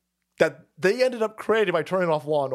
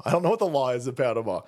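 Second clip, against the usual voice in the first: normal talking voice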